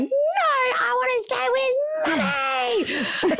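put-on high, slow, crying child's wail; a child sobbing for her mum; crying toddler. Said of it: "she was like".

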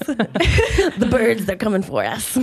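laughing conspiracy theorist voice; a hint of a paranoid truther. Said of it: "It's my conspiracy theorist voice".

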